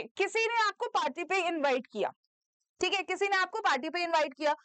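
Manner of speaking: normal talking voice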